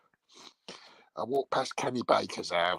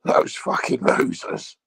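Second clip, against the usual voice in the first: drawn-out mock insult; 6 dB louder, slower than usual.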